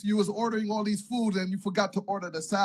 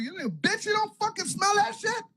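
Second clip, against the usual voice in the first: wife snapping back; 12 semitones higher than usual. Retold or imitated. Wife voiced answering back.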